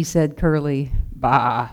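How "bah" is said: low, dismissive sheep's baa; sheep bleat. Acted. Grumbling sheep reacts to Daisy going missing, low bleat.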